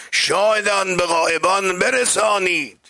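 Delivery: high, ringing exhortation; proclaimed command. Retold or imitated. Preacher as the Prophet bidding witnesses tell the absent, high ringing call. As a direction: cry the order out to a crowd.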